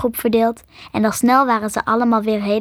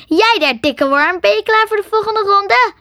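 high, loud challenge; shrieking taunt; 10 semitones higher, 4 dB louder than usual. Acted. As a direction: yell a dare at the snake. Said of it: "gilde Marie". (gillend en uitdagend, hoog en luid)

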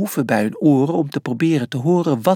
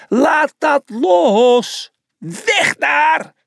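loud, high, stretched-out warning; alarmed yelling; 13 semitones higher, 4 dB louder, much slower than usual. A narrator's reading. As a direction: holler it slowly to carry far. Yelling warning to distant brother.

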